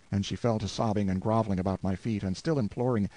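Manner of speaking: ordinary delivery, nothing marked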